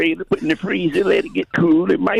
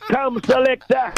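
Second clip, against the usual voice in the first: usual speaking voice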